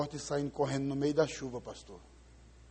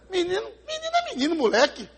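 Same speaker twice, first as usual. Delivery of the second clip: scornful; 13 semitones higher, 6 dB louder than usual. Real, not acted. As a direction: spit out the word menino with disdain. Pastor scorns the favela boys.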